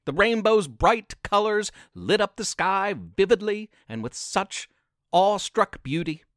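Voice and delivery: ordinary delivery, nothing marked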